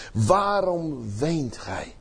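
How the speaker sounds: slow, soft "Waarom" drawn out tenderly; gentle Jesus. Retold or imitated. Jesus asks the weeping Maria why she cries, slow and soft. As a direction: ask it softly and slowly, leaning on "Waarom". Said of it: "Jesus asks Maria at the tomb".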